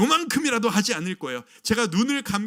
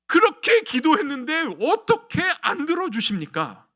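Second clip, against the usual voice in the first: mock disappointment; 8 semitones higher, 7 dB louder than usual. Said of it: "라고 하는 실망".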